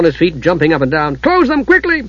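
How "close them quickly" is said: urgent dwarf. A narrator's reading. Dugan urging Usha to close the gem. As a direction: urge her on, jumping.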